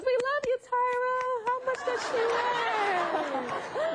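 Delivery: high, drawn-out fan call; mock cheering in a sustained high voice; gushing audience. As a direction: imitate an adoring crowd with a nosy afterthought. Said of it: "the whole audience goes".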